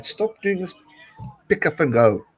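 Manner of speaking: pitched high, pleading at the screen; high exasperated plea at the TV; frustrated urging. Real, not acted. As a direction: beg the players to stop it. Urging team on TV.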